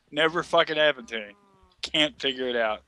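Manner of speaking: ordinary talk, nothing marked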